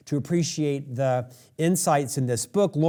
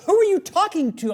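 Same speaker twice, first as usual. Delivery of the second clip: mock wife calling in, pitched much higher; imitating wife; 4 dB louder than usual. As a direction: play the wife poking her head in, higher voice. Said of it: "My wife will come into the bathroom".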